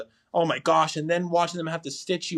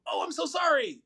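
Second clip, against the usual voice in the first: quoting his wife's startled apology, much higher; imitating wife, high apology; 12 semitones higher than usual. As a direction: echo her surprised little apology up high. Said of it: "All I heard was her go".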